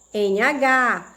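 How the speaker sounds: usual speaking voice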